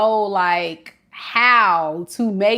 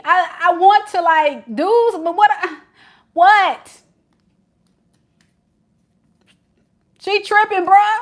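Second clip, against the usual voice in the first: high, loud puzzled voice; confused boyfriend; 10 semitones higher, 5 dB louder than usual. Retold or imitated. Reader voicing the baffled boyfriend complaining about Shay, high. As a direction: sound lost and baffled.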